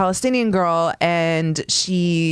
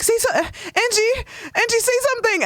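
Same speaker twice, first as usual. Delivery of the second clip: urgent pleading. Retold or imitated. Kid begging her big sister to speak up, very high. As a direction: beg her to back you up.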